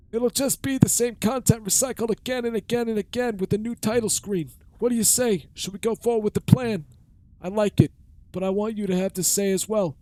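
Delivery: put-on high creaky old man; old man voice, pitched high. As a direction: play a rickety old man. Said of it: "old man voice".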